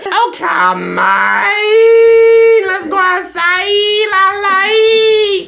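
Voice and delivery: very high, drawn-out singsong calling; exaggerated high pet-owner singsong; dog-talk voice. Shown as a demonstration. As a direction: summon the dogs in squealing baby talk. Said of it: "So he adapted it".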